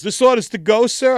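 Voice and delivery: high, loud timid cashier voice; a scared clerk asking politely; nervous cashier. Retold or imitated. Clerk nervously asking the man in prison pants, high and loud.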